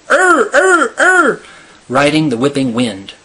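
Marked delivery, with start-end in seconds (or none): 0.1-1.4 high, loud imitated bird calls; thunderous seabird cries. A narrator's reading. Narrator imitating thousands of nesting birds, thunderous seabird cries. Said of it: "a great thunder of".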